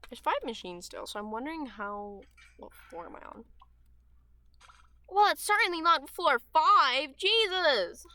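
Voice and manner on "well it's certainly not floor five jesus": high and loud, suddenly jolted; real startled shout at the game; startled outburst. Real, not acted. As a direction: yelp as something jumps out. Startled by a jump scare in game.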